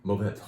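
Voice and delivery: Mourvedre with the final "dre" just breathed, almost silent; last syllable breathed out. Shown as a demonstration. Wine shop owner teaching customers the name, last syllable breathed out. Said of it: "you breathe out the last one".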